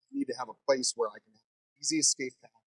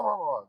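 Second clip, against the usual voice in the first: angry mumbled roar; 5 semitones higher than usual. Retold or imitated. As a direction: mimic a mad, wordless shout. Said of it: "I hear him mumbling, and he's just getting really mad".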